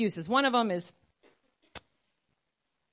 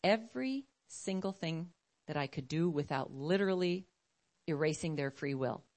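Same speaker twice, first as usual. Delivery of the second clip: grave, unhurried divine reply; voice of God; 6 semitones lower than usual. Acted. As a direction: answer in a lowered, measured voice with weighted pauses. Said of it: "And he's like".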